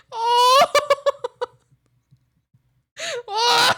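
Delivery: high, loud laughing shriek; laughing cry. Real, not acted. Overcome by the funny memory, a loud laughing shriek.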